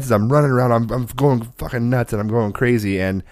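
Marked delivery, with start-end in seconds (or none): none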